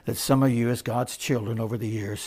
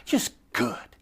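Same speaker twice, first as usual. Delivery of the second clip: ordinary talk, nothing marked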